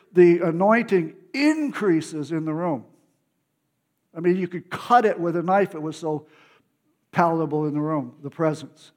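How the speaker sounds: ordinary delivery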